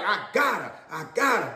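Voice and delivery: quiet gloat. Retold or imitated. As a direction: say it under your breath, pleased. Gloating to himself.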